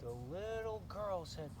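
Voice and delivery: plain reading voice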